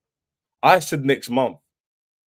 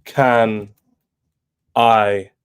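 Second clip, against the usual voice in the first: louder, bellowing each word apart; big shouted catchphrase; 4 semitones lower, 6 dB louder, much slower than usual. Acted. Streamer booming his opener at chat. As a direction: roar it out to open the stream.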